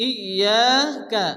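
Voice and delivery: ইয়াকা slowly with doubled ya stretched. Shown as a demonstration. Teacher demonstrating shadda in ইয়াকা. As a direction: press and hold the ya of ইয়াকা. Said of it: "এক আলিফ পরিমাণ টান দিয়ে পড়তে হবে".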